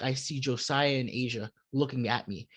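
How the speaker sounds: ordinary talk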